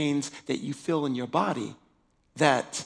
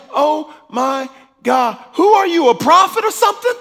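amazed outcry; 13 semitones higher, 14 dB louder than usual. Retold or imitated. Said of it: "And she like".

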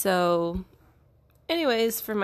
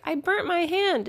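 much higher, a pained whine; retold whimper of pain; 10 semitones higher than usual. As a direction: whine it like a hurt child. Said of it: "and I was like".

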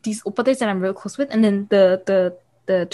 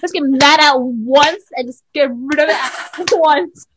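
slow, loud imitation breaking into laughter; laughing mock rant; 5 semitones higher, 4 dB louder, slower than usual. Mocking mom's anger at all siblings, laughing rant. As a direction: exaggerate mom's logic slowly, losing it to laughter. Mocks mother's reasoning, laughing.

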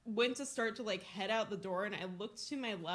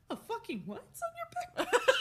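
baffled mom; 13 semitones higher, 4 dB louder than usual. Retold or imitated. Mom's baffled reply quoted.

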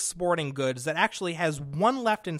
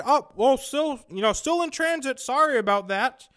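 mock cheery service rep; 5 semitones higher, 4 dB louder than usual. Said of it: "And they said".

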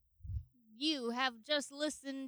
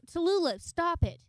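scolding; 5 semitones higher, 3 dB louder than usual. Pretend. Owner scolding her dog.

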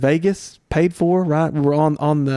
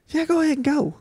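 much higher, a light wife's voice; 12 semitones higher than usual. Retold or imitated. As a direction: play the wife brushing it off. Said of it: "She said".

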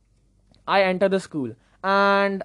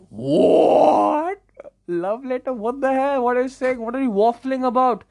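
shocked disbelief; 5 semitones higher, 5 dB louder than usual. Retold or imitated. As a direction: react as if wrongly accused. Acted shock at love letter news.

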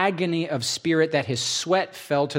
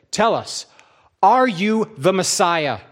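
demanding; 6 semitones higher, 5 dB louder than usual. Acted. Council demands an answer.

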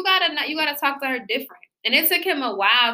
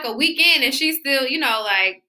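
ordinary talk

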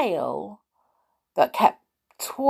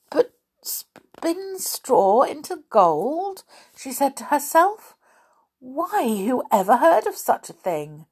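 put-on bewildered girl; 7 semitones higher than usual. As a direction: puzzle it out, half to yourself. Girl's bewildered questions.

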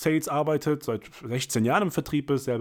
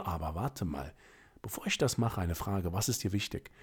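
much softer, lower, slowed down; calm question; 5 semitones lower, 9 dB quieter than usual. Shown as a demonstration. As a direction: drop the voice to draw the customer in. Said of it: "und sagst". (leise und tiefer, ruhig nachgefragt)

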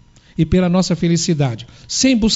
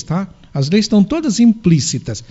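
ordinary delivery

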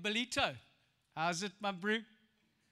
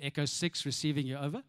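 much lower radio operator; radio call voice; 9 semitones lower than usual. Retold or imitated. Boy calling his farmer friend on radio. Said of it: "he would respond".

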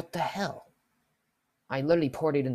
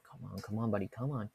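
impatient muttering; 4 semitones lower, 8 dB quieter than usual. Retold or imitated. Muttered impatience while waiting.

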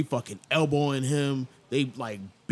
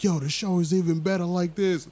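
higher, louder enthusiasm; excited fan; 7 semitones higher, 4 dB louder than usual. Retold or imitated. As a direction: rave about it. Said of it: "And was like".

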